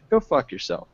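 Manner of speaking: normal talking voice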